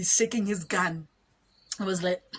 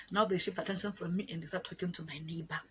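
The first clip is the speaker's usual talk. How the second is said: ordinary talk, nothing marked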